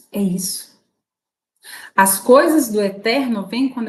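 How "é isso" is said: moved whisper. Real, not acted. Closing emotional point.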